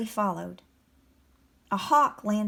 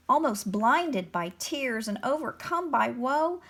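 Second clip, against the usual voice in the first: ordinary delivery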